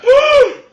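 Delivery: sharp high gasp of a victim hit; pained gasp. Acted. Game master as goblin hit by the arrow, pained gasp. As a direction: gasp loudly as if struck.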